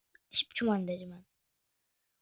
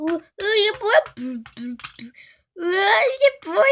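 startled yelp; 8 semitones higher, 12 dB louder, slower than usual. Acted. Hit by invisible attacker.

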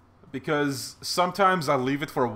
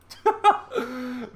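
excited laugh; 11 semitones higher, 4 dB louder than usual. Real, not acted. Laughing at the win.

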